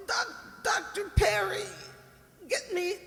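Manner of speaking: high, loud, gasping call for help; desperate calling out. Acted. Mother in a seizure calling for Doctor Perry, high and desperate. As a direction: call for the doctor in a panic, rising to a cry.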